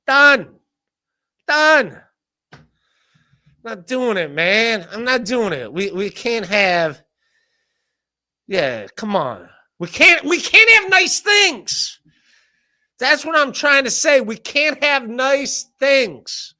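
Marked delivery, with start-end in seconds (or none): 0.1-2.0 exasperated shout. Real, not acted. Emphatic quitting. 9.8-16.5 exasperated shout. Real, not acted. Exasperated outburst.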